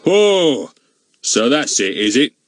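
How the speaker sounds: rough. Acted. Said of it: "said Goon roughly".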